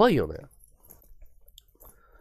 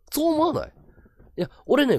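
normal talking voice

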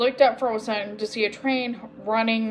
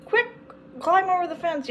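higher, urgent call; mild wild yell; 8 semitones higher than usual. A narrator's reading. Agatha urging the others over the fence, mildly yelled. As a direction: urge them on without much volume. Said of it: "yelled Agatha wildly".

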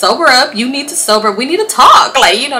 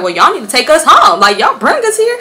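demanding; 7 semitones higher, 5 dB louder, faster than usual. Retold or imitated. Arguing with him to go home.

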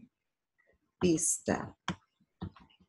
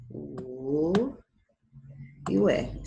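normal talking voice

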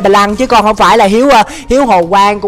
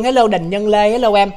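normal talking voice

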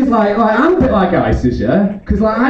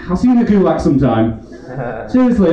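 ordinary delivery

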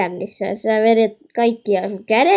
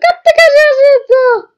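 much higher, loud excited yell; shouted excitedly; 9 dB louder than usual. Real, not acted. Gamer shouting to viewers about Granny's pose, loud excited yell. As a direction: blast kattokaa out like a cheer. Repeats line then shouts it.

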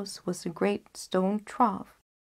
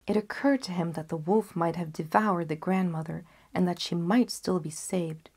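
usual speaking voice

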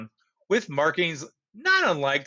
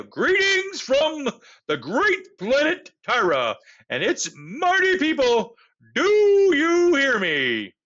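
booming alien broadcast; 8 semitones higher, 6 dB louder than usual. A narrator's reading. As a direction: boom it out over the radio. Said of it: "the voice louder now".

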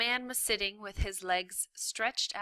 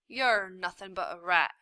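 loud scornful prisoner; gruff prisoner; 8 dB louder than usual. A narrator's reading. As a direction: sneer at the rat.

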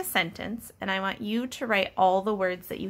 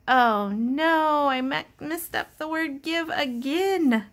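a hammed-up higher oh no; mock dismay; 7 semitones higher, 4 dB louder than usual. Teacher plays dismayed at the scrambled letters. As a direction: pretend to be upset, playfully.

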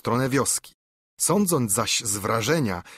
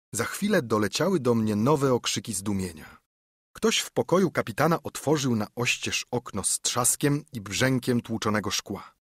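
ordinary delivery, nothing marked

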